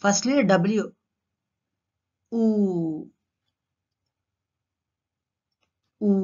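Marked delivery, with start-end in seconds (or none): 2.3-6.1 "ఊ" as a long, rounded oo; W lip-rounding demo. Shown as a demonstration. Teacher showing how the mouth starts a W, drawn-out rounded "ఊ". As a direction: draw out "ఊ" with lips pushed forward. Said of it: "Our mouth goes like this".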